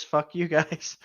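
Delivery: ordinary talk, nothing marked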